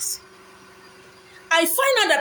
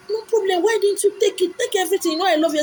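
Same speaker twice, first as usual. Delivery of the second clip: a higher, lightly put-on friend voice; a hint of the generous best friend; lightly voiced eager friend; 7 semitones higher than usual. Best friend offering everything, lightly voiced higher.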